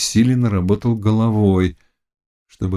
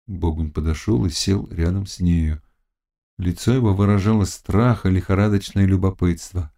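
plain reading voice

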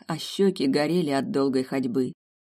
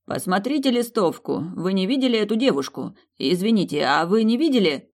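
calling out; 5 semitones higher, 4 dB louder than usual. Acted. Harold calling out to crowd.

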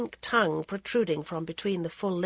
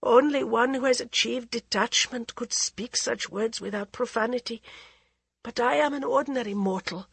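a queen's anxious plea, a little higher; pleading queen; 5 semitones higher than usual. Acted. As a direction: beg the holy woman for a remedy. Maharani answers fearful.

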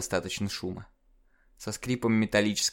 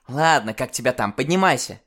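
громко и выше, командуя; резко позови её встать; бойкий окрик; 3 semitones higher, 5 dB louder than usual. Acted. Героиня зовёт подняться. (громкий окрик)

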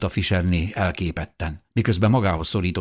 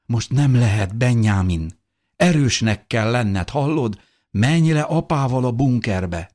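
higher, emotional urging, slowing; firm pleading; 5 semitones higher, 4 dB louder than usual. A narrator's reading. Mother tells her son to go down, pleading. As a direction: urge the child to be strong.

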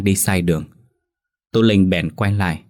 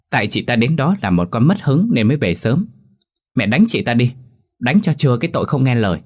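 put-on higher, spiteful girl egging her mother on; a sly daughter urging her mother to beat her stepsister, higher pitched; tattling younger sister; 4 semitones higher than usual.

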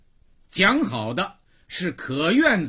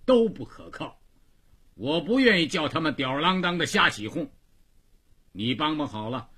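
high, emphatic employer giving orders; bossy rickshaw-yard master; 6 semitones higher, 5 dB louder, faster than usual. Acted. Boss tells Xiangzi to sweep the snow, emphatic raised orders. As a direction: lay down the chores in a raised voice.

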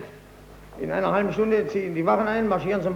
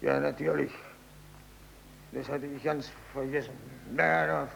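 enttäuschtes Murmeln; 3 semitones lower, 11 dB quieter than usual. Acted.

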